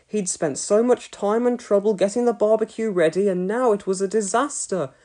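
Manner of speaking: ordinary delivery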